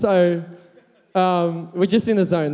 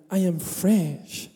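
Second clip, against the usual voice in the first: slow, soft put-on French accent, lightly done; mock French accent; 3 dB quieter, slower than usual. French boy introducing himself, in a mock French accent. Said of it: "French accent".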